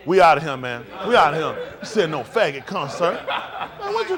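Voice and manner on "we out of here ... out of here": loud, fed-up exit call; walking out. Retold or imitated. An audience storms out of a concert, calling out loudly. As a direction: shout it like the whole crowd leaving.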